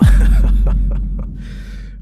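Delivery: a loud, breathy cackle of laughter; loud burst of laughter. Real, not acted. Host cracks up at the crystal ladies joke, loud breathy laughter.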